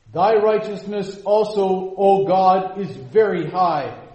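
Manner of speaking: loud, high and slow, proclaiming; fervent preaching. Acted. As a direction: declaim it slowly in rising praise. Quoting Psalm seventy-one fervently.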